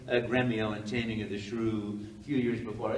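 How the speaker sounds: ordinary talk, nothing marked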